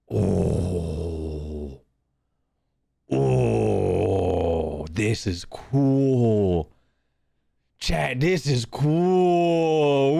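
very slow, low, drawn-out awe; slow awed. Pretend. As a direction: savor each word in wonder.

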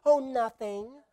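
high, laughing, lovestruck gush; lightly voiced giddy young bride; dreamy fiancee. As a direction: imitate a besotted fiancee. Mimicking a smitten fiancee.

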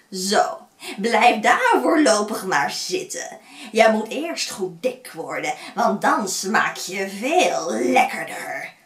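loud, gloating witch voice. Acted. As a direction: taunt the caged boy. Witch locks Hans up.

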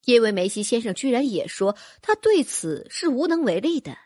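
usual speaking voice

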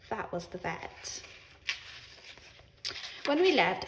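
plain reading voice

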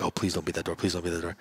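low, softly pleading inner thought; quiet dread. Retold or imitated. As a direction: plead under your breath, low. Dreading the door, retold thought.

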